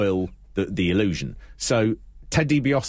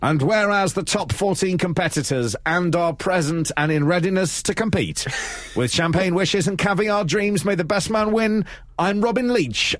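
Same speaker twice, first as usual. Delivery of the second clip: higher, grand showbiz announcer voice; hammed-up celebrity host reading a pompous scroll; Robin Leach impression; 6 semitones higher than usual. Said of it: "he's got a very strange voice".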